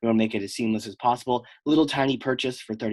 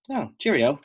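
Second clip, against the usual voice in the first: light put-on British accent; mock British accent. Host imitates U K listeners reacting, mock British accent. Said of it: "the U K listeners are like".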